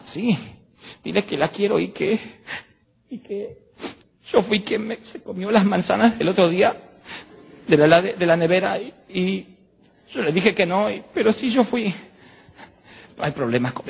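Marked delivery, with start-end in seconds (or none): 0.0-11.9 lightly voiced little boy, higher; a young son confessing shyly; boy's voice, higher pitched; child's voice. Preacher voices little Isaac confessing.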